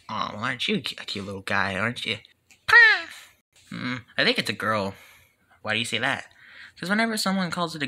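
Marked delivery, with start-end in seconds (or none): 2.7-3.3 very high, loud creature squeak; squeaky Pokemon. Acted. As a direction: chirp it sharply like a tiny pet. Creature voiced in a skit.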